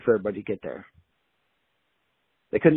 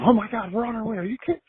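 much higher, excited squeal of disbelief; wife hearing her husband killed the big buck, excited; flipping out; 10 semitones higher, 4 dB louder, faster than usual. Retold or imitated. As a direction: burst out in shocked delight. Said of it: "And she flips out".

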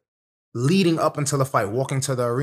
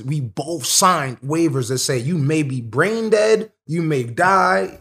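slow, each word heavy; stressing how serious it is; slow, weighty emphasis; 4 semitones higher, slower than usual. Real, not acted. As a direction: lay out the grim facts slowly. Stressing grim reality of waivers.

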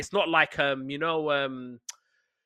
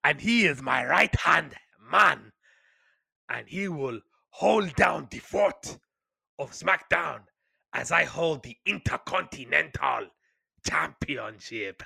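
put-on slow, deliberate parody African accent; pompous Nigerian-accented promo with long pauses; mock Nigerian accent; slower than usual. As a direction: draw out every phrase in a Nigerian accent. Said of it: "We want you to do a Nigerian accent".

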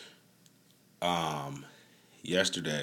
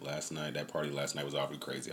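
normal talking voice

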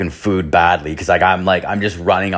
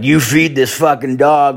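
emphatic demand; 6 semitones higher, 4 dB louder than usual. Retold or imitated. Drunk narrator tells homeless guy.

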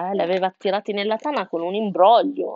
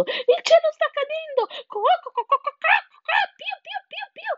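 very high frantic bird alarm; cheeping and squawking. A narrator's reading. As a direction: squawk and cheep at full tilt. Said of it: "pigolando e schiamazzando a più non posso". (pigolando e schiamazzando a più non posso)

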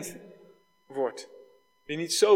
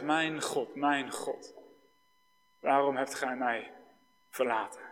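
a cry of abandonment turned inward, much softer; hushed anguished cry; 3 semitones lower, 6 dB quieter than usual. Retold or imitated. Preacher voicing Christ's cry to God, softly and anguished. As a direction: breathe the words low and softly, no shout. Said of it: "schreeuwde het uit".

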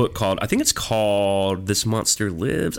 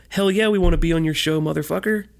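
excited; 9 semitones higher than usual. Real, not acted. Enthusiastic yes to guest invite.

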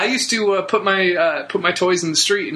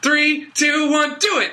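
excited lookout call; 7 semitones higher than usual. Retold or imitated. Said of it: "where they'd be like".

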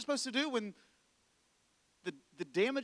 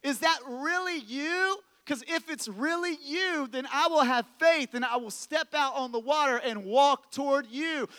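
Peter; 6 semitones higher, 4 dB louder than usual. Acted. Peter calling to Jesus from the boat, raised calling voice. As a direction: shout it out over a storm. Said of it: "his one job is to go".

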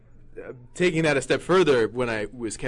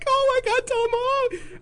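high, loud squealing fan; 5 dB louder, slower than usual. Retold or imitated. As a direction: shriek it like a starstruck fan. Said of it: "people were like".

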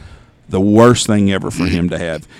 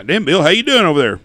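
loud, raised hearty hello across the room; Dad's hearty greeting; 7 semitones higher, 5 dB louder than usual. Retold or imitated. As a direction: call out to an old pal like Dad did. Dad greets old friends loudly.

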